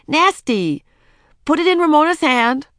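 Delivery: put-on high, loud babysitter commands; emphatic child's scolding in short bursts; crying out. A narrator's reading. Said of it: "cried Ramona".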